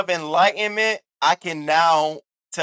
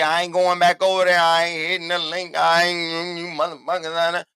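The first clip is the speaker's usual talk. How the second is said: imitating Castro; 3 semitones higher than usual. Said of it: "As much as Castro say".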